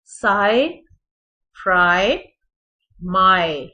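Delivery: फ्राई and माय with an ai glide; loud model words साय, फ्राई, माय; teacher drilling the ai sound; careful word demo. Shown as a demonstration. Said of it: "आई sound है ये".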